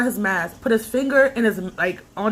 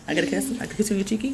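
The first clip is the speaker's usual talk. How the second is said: ordinary talk, nothing marked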